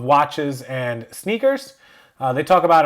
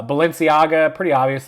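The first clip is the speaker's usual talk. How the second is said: ordinary talk, nothing marked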